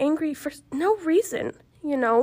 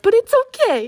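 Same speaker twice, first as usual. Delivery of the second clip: starting to cry; 10 semitones higher, 7 dB louder than usual. Real, not acted. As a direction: voice cracks high as tears come. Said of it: "God, I'm gonna cry".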